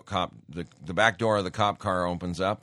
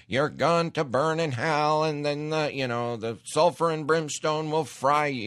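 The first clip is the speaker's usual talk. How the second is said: mock hellfire preacher; 6 semitones higher, 3 dB louder than usual. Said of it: "You don't go stand up and say".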